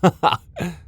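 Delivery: high, disbelieving laugh; incredulous laugh. Acted. Vampire laughing when told she'll be taken alive. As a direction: laugh at the absurd idea.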